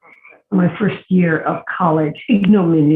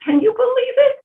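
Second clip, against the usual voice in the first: amazed. Real, not acted. Marvelling at moving in together.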